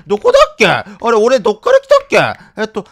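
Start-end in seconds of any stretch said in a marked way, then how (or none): 0.1-0.7 panicked. Real, not acted. Panicking lost.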